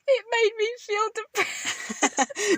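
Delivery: high, drawn-out mock lament; mock whining. Mocking the confession of deleting TikTok, high and slow. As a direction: stretch it out in a high, hammed-up voice.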